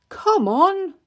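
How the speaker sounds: loud, high call to the family; hearty summoning shout. Acted. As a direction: call them over with a big lift. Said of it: "called Daddy Pig".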